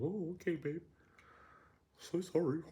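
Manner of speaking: high, simpering fake apology; sarcastically grovelling; mock meek apology. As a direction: put on a high, submissive little voice. Mimics a submissive man apologising.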